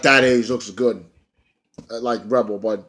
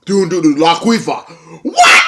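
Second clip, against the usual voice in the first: imitating Kong; 4 semitones higher, 5 dB louder than usual. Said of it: "Kong comes out".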